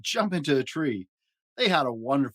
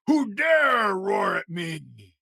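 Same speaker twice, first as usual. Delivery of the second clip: high, loud, very slow booming growl; a huge monster bellowing a threat; snarling big monster; 7 semitones higher, 5 dB louder, much slower than usual. A narrator's reading. Big monster snarling at the little ones who roared. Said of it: "It snarled".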